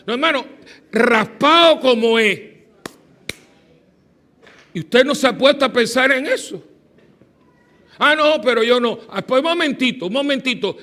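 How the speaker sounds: loud, high emphatic preaching; fiery preaching. Acted. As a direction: preach it to the congregation with fire. Preaching rises.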